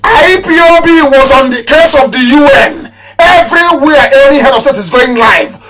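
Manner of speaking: high, loud proclamation; boasting loudly of the movement; boastful shout. Real, not acted. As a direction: shout it out in triumph. Boasting to his audience.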